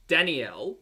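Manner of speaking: Danielle in three beats, dan-ee-ELL; second of three names. Shown as a demonstration. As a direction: sound the middle "ee" in Danielle. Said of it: "there's actually three different names".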